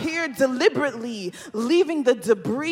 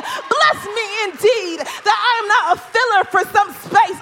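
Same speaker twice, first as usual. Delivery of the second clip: escalating preaching refrain; 8 semitones higher, 7 dB louder than usual. Acted. Preacher pleading for blessing before the congregation, building to a shout. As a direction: bless me repeated, climbing higher and louder.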